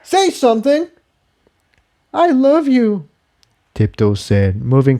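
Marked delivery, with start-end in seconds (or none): 0.0-0.9 snapping. Acted. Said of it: "Jigsaw snapped". 2.1-3.1 high, tender confession; lightly voiced mare, sincere; voiced mare confessing. Character speaks.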